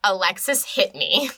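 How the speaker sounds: ordinary delivery, nothing marked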